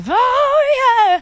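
high, eager oh yeah; high excited agreement. Retold or imitated. Retold eager reply.

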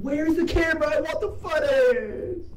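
panicked yelling at the game; panicking gamer. Real, not acted. Gamer loses camera footage.

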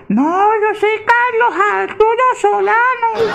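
aguda y lenta de niño pequeño; voz de niño. Acted. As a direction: habla como un niño inocente. Niño equivocado se presenta. (niño muy agudo contando a su papá)

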